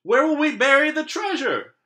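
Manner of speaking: high, loud frantic question; frantic cry. Acted. Captain fretting to the crew in the storm. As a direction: cry it out in despair.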